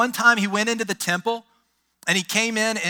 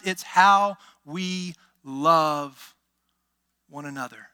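drawn-out emphatic point; 3 semitones lower, slower than usual. Acted.

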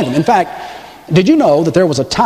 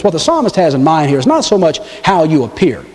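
normal talking voice

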